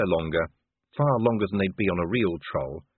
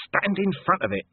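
ordinary delivery

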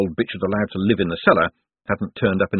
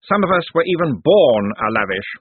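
higher-pitched, pointed reproof; stern; 6 semitones higher, 4 dB louder than usual. A narrator's reading. Cosmo reminds Josephine who was born family, stern. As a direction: rebuke a relative sternly. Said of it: "he said sternly".

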